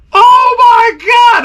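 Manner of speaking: high, loud, slow "oh my God"; stunned shout. Retold or imitated. Angler seeing his personal best, stunned shout. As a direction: drag out the shock. Biggest fish jumps.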